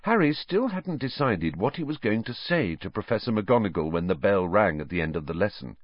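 usual speaking voice

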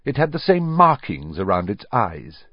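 ordinary delivery, nothing marked

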